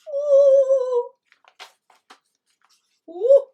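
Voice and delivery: high, loud shocked ooh; startled ooh. Acted. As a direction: yelp as if pinched. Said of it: "It's like someone's pinched his bum".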